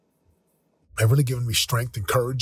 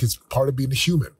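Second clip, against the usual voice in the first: ordinary delivery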